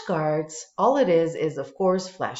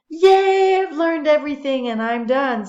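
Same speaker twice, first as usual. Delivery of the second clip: much higher and louder, a triumphant yay; excited cheer; 9 semitones higher, 9 dB louder than usual. Real, not acted. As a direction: cheer like you've just won. Finishing the quiz activity.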